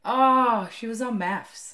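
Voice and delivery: mock dawning recognition; retold realisation. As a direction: let the penny drop loudly, played up. Retold reveal of who she is.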